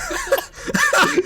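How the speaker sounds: high shrieking laugh; loud burst of laughter. Real, not acted. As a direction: cackle loudly. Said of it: "We tried to whisper here".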